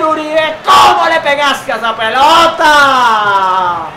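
high, loud, drawn-out goal call; breakneck goal commentary. Real, not acted. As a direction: stretch every word, shouting the goal. Live goal call held.

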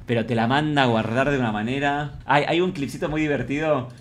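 ordinary talk

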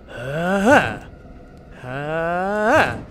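rising effortful "hah"; ramming grunt. Pretend. Player ramming a jammed door, rising grunts. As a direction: wind up a grunt that bursts at the end. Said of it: "Oh, I'm winding up".

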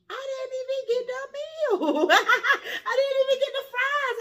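real laughing cry of indignation; laughing outrage. Real, not acted. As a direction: howl it in disbelief. Outraged over the cheap sandwich.

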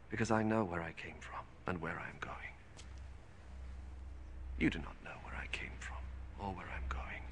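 breathy hushed whisper to accusers. Acted. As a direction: whisper it, intimate and grave. Jesus answering the Pharisees.

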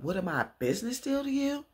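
high, offended question; mock offended daughter, high; indignant retort. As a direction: demand it, insulted.

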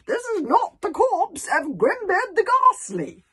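slow, high, full-volume shout; yelling. A narrator's reading. Old Wrinkly making the hooligans understand, yelling. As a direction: bellow it word by word. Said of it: "he started yelling".

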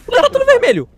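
alarmed shout. Real, not acted. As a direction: panic rising as you repeat it. Alarme no jogo. (grito alarmado)

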